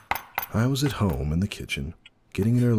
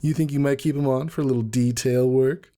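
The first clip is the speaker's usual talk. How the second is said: high, sly, insinuating question; hint at something naughty; suggestive tease; 10 semitones higher, 4 dB louder than usual. Acted. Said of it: "her tone made it very clear".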